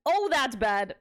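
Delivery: ordinary talk, nothing marked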